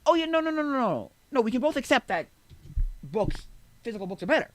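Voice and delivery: high, loud rapid string of no's; frantic conceding. Retold or imitated. Acting out his counter-argument.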